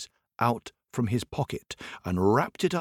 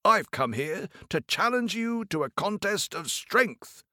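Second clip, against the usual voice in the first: higher tailor voice calling up; 8 semitones higher than usual. Acted. Tailor challenging the giant, lightly higher call. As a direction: proclaim the challenge boldly. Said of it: "called the tailor".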